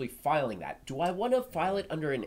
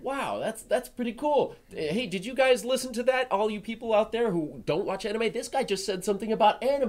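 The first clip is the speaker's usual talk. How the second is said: higher and louder, calling out to everyone; excited; 10 semitones higher, 7 dB louder than usual. Pretend. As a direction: hype it up to the whole crowd. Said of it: "and I'm like".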